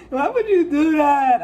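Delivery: high, loud wailing sob of a friend; mock crying friend. Friend crying over the news, high tearful wail.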